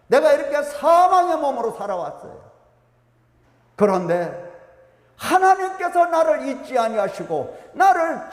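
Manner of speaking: a pastor's impassioned climax; fervent preaching. Acted. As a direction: preach it with mounting fervour. Preaching at full force.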